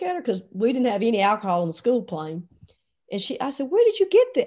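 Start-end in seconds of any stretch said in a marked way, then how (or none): 3.7-4.4 high, loud astonished question. Retold or imitated. Coach handed whiskey on school plane, astonished. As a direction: blurt it out in disbelief.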